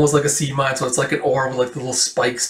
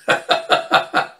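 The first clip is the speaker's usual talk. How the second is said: laugh. Real, not acted. Narrator laughs at the comic.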